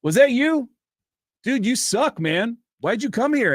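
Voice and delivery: high, mocking call-out; bullying taunt. Comics putting down the beginner who bombed, high taunting voice. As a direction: imitate a comic picking on someone.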